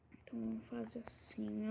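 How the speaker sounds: hushed whisper. Real, not acted. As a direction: whisper it to yourself. Quietly planning while building.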